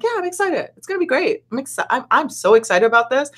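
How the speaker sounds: high, gushing excited retelling. Retold or imitated. Insisting to doubtful speakers she's excited, gushing. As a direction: sell it like you can't wait. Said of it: "I'm like".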